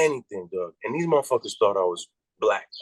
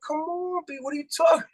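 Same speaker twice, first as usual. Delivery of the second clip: high, fast, laughing disbelief; incredulous. Retold or imitated. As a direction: squeal it like you can't believe the request. Hearing the shark fin request.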